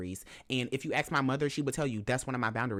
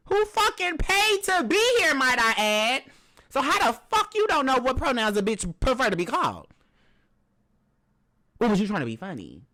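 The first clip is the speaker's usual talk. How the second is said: much higher and louder, indignant yelling; high-pitched outraged shouting; furious rant; 12 semitones higher, 6 dB louder than usual. Real, not acted. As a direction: hurl each question at her. Ranting at critics and the woman.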